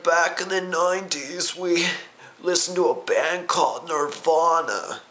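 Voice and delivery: high-pitched mock old-timer; old man voice. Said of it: "have that old voice".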